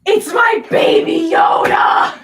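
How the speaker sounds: loud, high, drawn-out cry of delight; thrilled shout. Real, not acted. Announcing the surprise package to a companion, thrilled shout. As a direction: yell it, overjoyed at the surprise.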